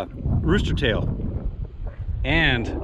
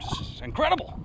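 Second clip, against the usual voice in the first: ordinary talk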